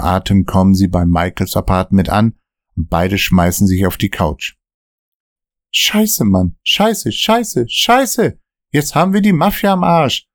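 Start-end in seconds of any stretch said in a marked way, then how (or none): 5.7-10.3 much higher, frightened cursing; scared stiff of the Mafia; fearful Dan. Acted. Dan on the couch to Michael, frightened and high. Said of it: "mit ängstlicher Stimme".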